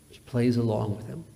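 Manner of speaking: ordinary talk, nothing marked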